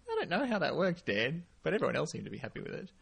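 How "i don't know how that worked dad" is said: higher-pitched, lightly mocked retort; imitating Wade. Wade quoted at the stage door, light higher-voiced imitation. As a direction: quote him with a higher lift and a little laugh. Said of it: "Wade go-, going".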